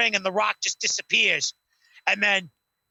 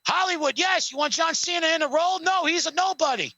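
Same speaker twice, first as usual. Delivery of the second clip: high, cocky mock phone call; high swaggering Rock on the phone; Rock impression; 7 semitones higher than usual. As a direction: play The Rock taking a fake call.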